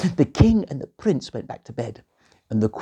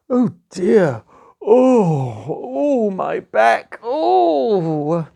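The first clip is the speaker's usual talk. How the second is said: high groaning complaint about back; aching groan; 9 semitones higher, 5 dB louder, much slower than usual. Acted. Princess coming down after a bad night, groaning. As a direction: moan like your back aches.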